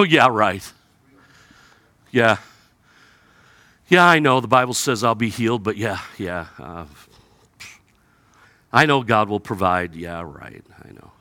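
low, dry mock agreement; mock the promise sarcastically; sarcastic. Believer doubting God's promise, low sarcasm. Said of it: "it's a level of sarcasm".

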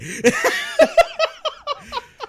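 squealing laugh at the memory; high helpless laugh. Real, not acted. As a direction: break into a high cackle.

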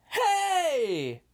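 high, loud goofy yell; goofy shout. Retold or imitated. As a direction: bark it like a cocky jock. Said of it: "Matt turns around and goes".

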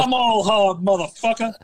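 mock tough-guy line pitched high; hammed-up movie tough guy, high and brash; imitating a film hard man, high pitched; Jerry Maguire quote. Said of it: "Jerry Maguire".

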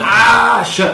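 high, loud startled scream, played for laughs; mock panicked yell. Imitating astronauts who see an insect, mock panicked yell. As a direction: yelp in comic alarm. Acting out the crew's panicked reaction.

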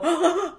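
short, high mock gasp; imitated gasp. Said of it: "and they'll go".

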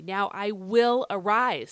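"will" punched loud and drawn out; WILL stressed strongly in "I will arise". Shown as a demonstration. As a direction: lean hard and slow on the word "will". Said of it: "if you heard me do that".

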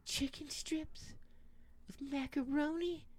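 put-on very high, slow, soft child voice; kid's voice. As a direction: stretch it out like a little kid. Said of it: "this kid's voice goes".

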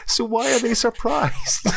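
high-pitched incredulous laughing question; amused, voice pitched right up. Real, not acted. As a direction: ask it through helpless laughter. Laughing at viewers' surprise.

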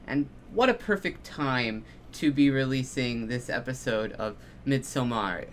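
usual speaking voice

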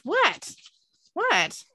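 high-pitched, touchy question; acted indignant, pitch jumping up; defensive challenge. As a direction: challenge the people staring at you. Dean snaps at the staring companions.

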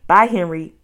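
ordinary delivery

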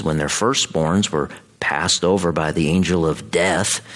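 ordinary talk

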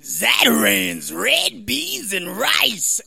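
slow, drawn-out ad jingle voice; Zatarans commercial voice. Retold or imitated. Imitating a rice commercial to the crowd, drawn-out announcer voice. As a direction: stretch each word like a TV pitchman. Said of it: "in the commercial is always like".

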